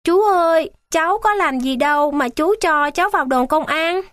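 put-on high, pleading child's cry; a small boy wailing his innocence; boy begging the guard, mildly tearful and high; child bursting into tears. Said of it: "Ân khóc òa lên".

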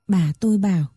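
ordinary delivery, nothing marked

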